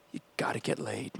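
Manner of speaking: hushed urgent whisper to himself; whispered pep talk. Retold or imitated. Teen whispering a secret pep talk into the mixtape microphone. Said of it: "I got on the microphone".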